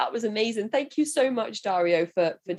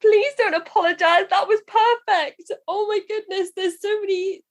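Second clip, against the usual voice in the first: high, gushing delight; bursting with admiration for the verse; excited praise; 11 semitones higher, 3 dB louder than usual. Real, not acted. Host raves to the guest right after his verse, high and excited.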